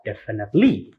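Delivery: "definitely" as definite-LY; wrong last-syllable stress. Shown as a demonstration. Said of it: "It's not in second syllable, like".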